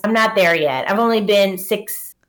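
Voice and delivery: ordinary delivery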